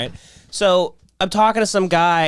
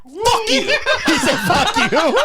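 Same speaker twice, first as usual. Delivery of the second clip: much higher, cracking up mid-curse; laughing retort; 13 semitones higher than usual. Retold or imitated. Retelling his curse at the teacher, laughing. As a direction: spit it out while laughing.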